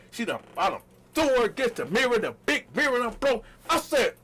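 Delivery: ordinary delivery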